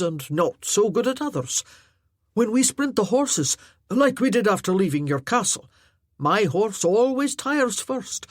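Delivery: lightly voiced Highland warrior, heartfelt; earnest Highland warrior. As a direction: speak plainly and earnestly to the lass. Arter continues his point.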